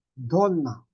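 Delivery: "Donna" with the n held long; double N. Shown as a demonstration. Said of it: "pag yung double N pa yan".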